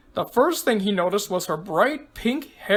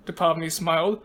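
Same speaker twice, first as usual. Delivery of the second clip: plain reading voice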